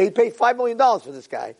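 normal talking voice

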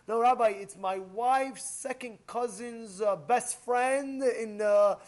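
slow, drawn-out list of distant relations; stalling excuse. Acted. Congregant excusing himself to the rabbi, slow and stalling. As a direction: stretch out the feeble excuse, word by word. Excuse for skipping the Torah class.

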